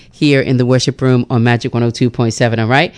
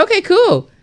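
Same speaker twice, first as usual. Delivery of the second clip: bright okay. Retold or imitated. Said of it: "I'm like".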